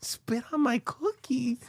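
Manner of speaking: teasing slow whisper; creepy whisper. Retold or imitated. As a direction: hiss it slow and creepy, like a secret request. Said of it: "Why would you say it like that?".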